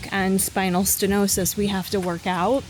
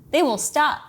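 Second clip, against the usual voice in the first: playful warning, slightly higher; mock threat; 5 semitones higher than usual. Real, not acted. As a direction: tease them with a threat.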